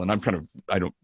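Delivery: normal talking voice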